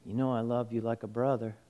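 soft, low, quick answer; subdued reply. Retold or imitated. Peter answering Jesus a second time, subdued reply. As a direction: answer quietly, voice dropped.

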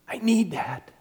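breathy whispered plea. Retold or imitated. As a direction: whisper it like a private confession. Whispered line inside the prayer.

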